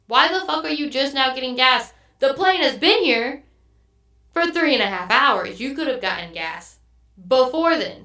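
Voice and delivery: retold outrage with emphatic, spaced-out phrases; pissed off. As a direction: demand an answer, hammering out the complaint. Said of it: "pissed me right off".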